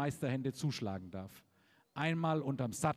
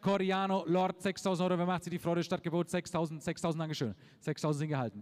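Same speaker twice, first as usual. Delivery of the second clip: brisk rhythmic auction bid calling; auctioneer; 3 semitones lower than usual. Real, not acted. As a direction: rattle off the bids like an auctioneer. Calls bids at horse auction.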